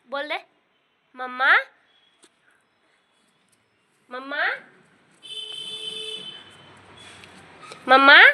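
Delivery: high, loud sing-song questions and rising calls. Real, not acted. Mother prompting baby to speak.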